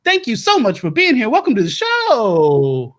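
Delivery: high-pitched, enthusiastic host greeting; gushing welcome. Acted. Host welcoming a guest onto the show, gushing. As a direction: sing out a big welcome, stretching the ending.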